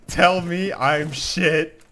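slow, drawn-out demand. Shown as a demonstration. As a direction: stretch every word slowly.

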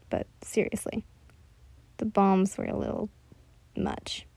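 hushed aside, breathy whisper. A narrator's reading. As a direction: whisper it like a secret. Aside, unvoiced lines.

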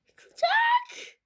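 high stretched wail of panic; panicked cry. Real, not acted. Tower wobbling, kid cries out in high panic.

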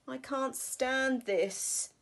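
slow exasperated bear. Acted. Same refrain repeated.

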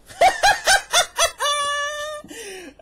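very high, loud extended cackle; shrieking laugh. Real, not acted. Streamer watching his teammate fall, very high loud laughter. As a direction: howl with laughter.

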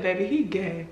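soft, knowing deadpan; low and certain; quiet knowing verdict. Retold or imitated. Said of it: "looked her dead in her soul and said".

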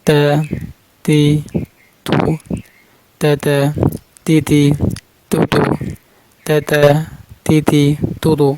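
তা, তি, তু with a thin, unthickened T; natural, light T. Shown as a demonstration. Teacher drilling learners on the plain letter, তা, তি, তু kept light. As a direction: drill তা, তি, তু lightly, tongue root down. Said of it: "স্বাভাবিক উচ্চারণ হবে".

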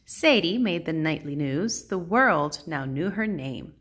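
ordinary delivery

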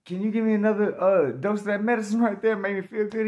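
high, loud acted asking; re-enacted high-pitched wheedling; eager request. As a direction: ask keenly for another dose. Acts asking nurse for more medicine.

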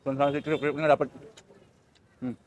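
ordinary talk

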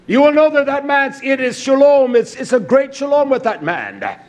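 loud, fast, driving sermon; fiery preacher building to a climax; full-force preaching. Acted. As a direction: preach it out hard to the congregation.

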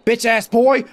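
loud, high-pitched insult; angry taunt. Real, not acted. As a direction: yell it at the enemy. Insults enemy in fight.

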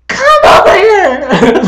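high, drawn-out yell across a room; yelling mom. Acted. Mom yelling at two squabbling sisters, loud high yell. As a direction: yell it like a mom ordering kids over. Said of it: "yelling at both of us".

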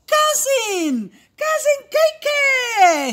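high, loud cheer of welcome; whooped, high-pitched hail; excited greeting. Acted. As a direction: shout out the arrival with glee.

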